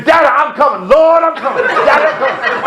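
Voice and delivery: high, wailing imitation of a praying passenger; imitated screaming. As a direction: mock-scream it to heaven as the plane goes down. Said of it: "the lady behind me is screaming".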